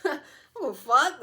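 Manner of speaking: high, loud, brazen declaration; gleefully defiant outburst; loud defiance. Acted. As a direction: proclaim not caring at full volume. Same words repeated, loud and defiant.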